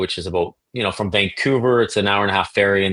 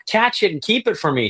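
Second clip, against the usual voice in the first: put-on much higher, eager childish plea; excited kid; 12 semitones higher than usual. Boy begging grandma to keep the snake, excited high plea. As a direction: beg like a thrilled little boy.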